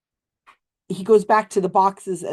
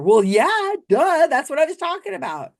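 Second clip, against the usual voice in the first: higher, louder condescending duh; chastising; 10 semitones higher, 5 dB louder than usual. Retold or imitated. Psychic scolds the woman for missing his point, loud and high. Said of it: "chastising her".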